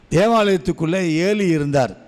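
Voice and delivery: normal talking voice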